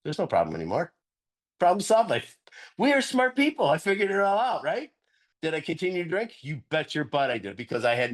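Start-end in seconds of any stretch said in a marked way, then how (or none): 1.6-4.9 mock smug. Mocking his old arrogance.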